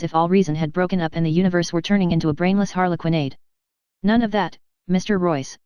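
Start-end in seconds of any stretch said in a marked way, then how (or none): none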